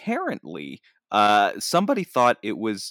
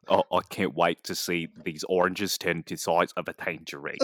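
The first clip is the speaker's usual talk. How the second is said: lower-pitched Cockney impression; Michael Caine; 4 semitones lower, 3 dB quieter than usual. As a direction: put on a Cockney film-star voice. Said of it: "do Michael Caine saying that".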